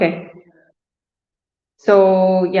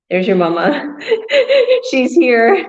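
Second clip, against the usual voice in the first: higher, laughing announcement; laughing delight; 5 semitones higher, 3 dB louder, slower than usual. Real, not acted. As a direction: announce her arrival through real laughter. Delighted at spotting a relative.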